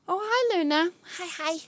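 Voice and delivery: high, loud, delighted hello to a pet; light up at the sight of the cat; gushing hello to Luna; excited high greeting. Real, not acted.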